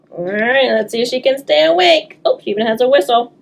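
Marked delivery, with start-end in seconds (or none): none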